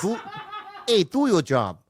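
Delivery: a Boston accent gone wrong; failed Boston accent. Shown as a demonstration.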